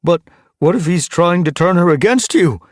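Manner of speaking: high, loud, urgent question; anxious. A narrator's reading. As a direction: plead it anxiously. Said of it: "Gorseclaw asked anxiously".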